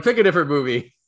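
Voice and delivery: mock exasperated. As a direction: act like teens fed up with him. Students groaning at repeated quote.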